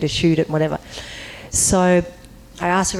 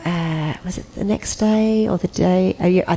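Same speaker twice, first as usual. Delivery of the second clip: normal talking voice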